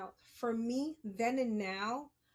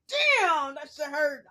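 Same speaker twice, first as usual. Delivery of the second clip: retold pain; 4 semitones higher, 8 dB louder, slower than usual. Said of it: "I was like".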